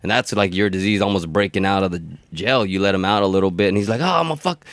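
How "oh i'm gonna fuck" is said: high, wild rioting prisoner voice; unhinged jailbird ready to burn it all; crazed inmate. Acted.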